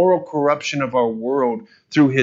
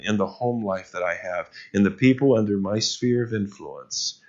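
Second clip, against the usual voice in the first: pastoral prayer; 4 semitones lower than usual. Acted. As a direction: pray for the church, gently and humbly. Pastor leads a congregational prayer.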